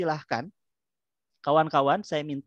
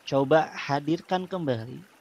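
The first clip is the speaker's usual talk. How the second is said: calm guided breathing voice; soothing spa whisper. Acted. Leads relaxation therapy.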